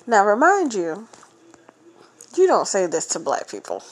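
ordinary talk

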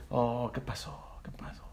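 hushed, slow insinuating qué pasó; sly teasing whisper. Retold or imitated. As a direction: whisper it like a double meaning. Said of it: "tú dices algo y dicen".

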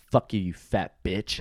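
retold insult, slow and deliberate; slow parting insult. Guest retells cursing clerk while walking out, slow and deliberate. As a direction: draw out the parting shot without raising the voice. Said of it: "I look that chick in the fucking face".

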